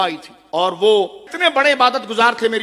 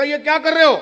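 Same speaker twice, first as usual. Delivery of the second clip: higher, alarmed shout down; startled question; 7 semitones higher than usual. Retold or imitated. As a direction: call out in sudden alarm. Startled saint calls down to mob.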